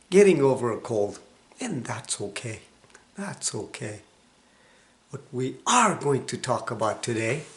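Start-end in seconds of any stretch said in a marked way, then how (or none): none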